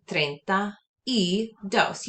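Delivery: "treinta y dos" very slow, with "y" drawn out; slow model. Shown as a demonstration. Teacher slowly models thirty-two for students. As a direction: stretch out each word slowly. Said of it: "To say thirty-two".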